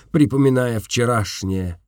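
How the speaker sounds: ordinary delivery, nothing marked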